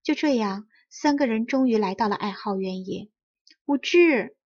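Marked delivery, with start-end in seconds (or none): 3.6-4.4 higher, louder call across the field; shouting the name. A narrator's reading. Mother calling her son across the moonlit field, loud high call. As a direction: call out to a child far away. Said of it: "发出了笛子一样的声音，冲着原野喊道".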